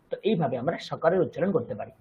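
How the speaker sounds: ordinary talk, nothing marked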